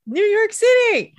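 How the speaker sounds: high, slow sing-song; playful echo. Pretend. Echoing the echo.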